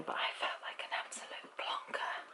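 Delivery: hushed, embarrassed whisper; sheepish whisper at own blunder; whispered confession. Real, not acted. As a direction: confess it under your breath. Embarrassed admission, confiding.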